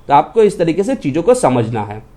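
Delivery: usual speaking voice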